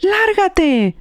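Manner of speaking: high mock yell of get out; shrill-free high mock shout; retold yelling. Imitating the voice yelling on the call, high mock shout. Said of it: "there's more yelling".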